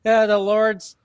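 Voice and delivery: high, earnest pamphlet-pusher voice; mock evangelist knocking on a stranger's door; door-to-door preacher. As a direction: play the eager missionary at the door.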